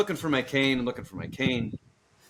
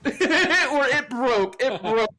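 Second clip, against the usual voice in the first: laughing imitation; 8 semitones higher, much slower than usual. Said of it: "she said".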